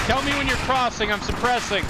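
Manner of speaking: combat shout. Real, not acted. Covering fire in firefight.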